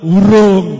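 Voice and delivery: grumpy husband answers loudly; gruff grunt. Acted. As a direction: bark it back at the wife. Husband's gruff reply to wife.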